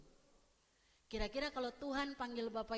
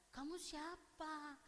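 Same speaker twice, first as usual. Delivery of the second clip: slow, softer questioning; puzzled; 3 semitones higher, 7 dB quieter, much slower than usual. Acted. Imagined Maria answering the Lord's call, slowly puzzled. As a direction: draw it out softly, unsure who this is. Acts Maria not recognising the caller.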